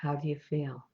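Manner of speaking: soft, unhurried meditation voice with long pauses; guided meditation. Acted. Meditation leader guiding listeners on an imagined ocean walk, soft and calm. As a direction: lead listeners gently inward, leaving space after each thought.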